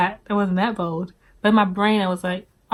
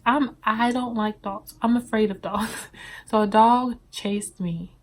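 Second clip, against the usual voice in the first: ordinary talk, nothing marked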